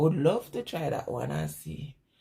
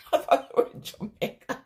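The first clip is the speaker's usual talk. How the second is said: laughing; 3 semitones higher than usual. Real, not acted. Laughing at own mistake.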